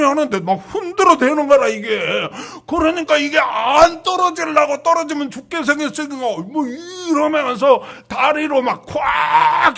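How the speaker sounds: loud straining cry while clinging on. Retold or imitated. Eaglet clinging to shaking nest, loud straining cry. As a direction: shout it as if holding on for life.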